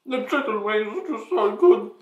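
tearful, strained voice; teary. Real, not acted. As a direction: speak through watering eyes. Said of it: "I'm literally tearing up".

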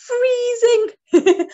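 high, loud, shivery cold voice; a teacher playing freezing for the class; shivering freezing voice. Shown as a demonstration. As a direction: act like you're freezing cold. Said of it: "but I sound like".

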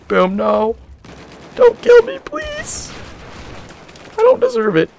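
shrill squeal of don't kill me; panicked high plea. Real, not acted. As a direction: beg for your life in real panic. Barrels explode near him.

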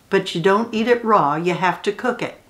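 plain reading voice

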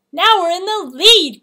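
high, loud cry of delight; excited cry. A narrator's reading. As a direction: cry out as if winning the race. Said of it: "cried Rat".

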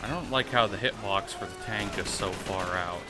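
ordinary talk

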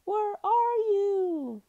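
long, high, drawn-out loud call; lost pup calling out slowly at full voice; frightened cry. A narrator's reading. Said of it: "suddenly afraid".

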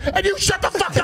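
high-pitched furious snapping; retold angry shout. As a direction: imitate an enraged dad turning on you. GP turns his rage on speaker.

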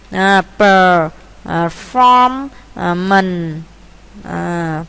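"performance" broken into per, form, mance with long gaps; syllable by syllable. Shown as a demonstration. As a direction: sound out "performance" one slow piece at a time. Teacher builds a word by syllables. (đọc tách từng âm "performance")

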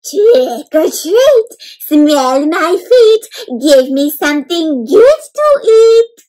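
high, loud, very slow vampire chant; Dracula voice. A narrator's reading. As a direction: draw out every word like a hammy vampire. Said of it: "in his best Dracula voice".

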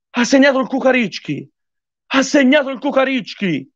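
high-pitched goal celebration; ecstatic goal shout. Real, not acted. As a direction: scream the goal with joy. Goal celebration live on stream.

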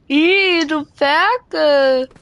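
loud, drawn-out playful ew; slow, exaggerated grossed-out scolding; mock disgust. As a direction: tease with a long, loud disgusted cry.

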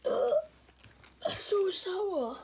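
drawn-out, high, loud complaint; sour-shock outcry. Acted. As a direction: cry out slowly at the sourness. Acted reaction to sour candy.

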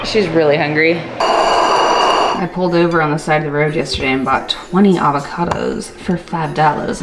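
usual speaking voice

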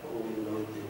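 soft, hushed prayer of blessing; quiet closing prayer. Acted. Pastor quietly blessing the congregation for the new year. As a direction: pray softly, letting it settle.

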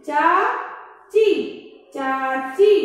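चाची stretched slowly, then चाची said quickly; slow then normal. Shown as a demonstration. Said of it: "इसके हम शब्द भी बता देते हैं".

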